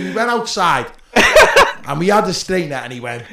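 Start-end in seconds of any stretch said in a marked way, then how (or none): 1.1-1.8 loud high burst of real laughter; high laughing shriek. Real, not acted. Telling the cancer punch story, shrieking laugh. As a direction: let the laugh squeal out.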